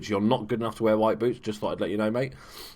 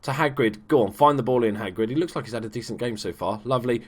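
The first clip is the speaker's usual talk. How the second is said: ordinary talk, nothing marked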